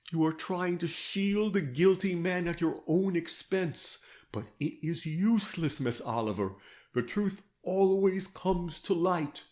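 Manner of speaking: a hint of a patient interrogator; detective Gryce. A narrator's reading. Detective counsels her.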